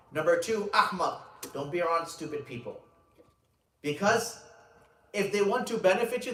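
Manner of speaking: high, loud, emphatic sermon delivery; preaching at full force. Acted. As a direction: preach it out, leaning on key words.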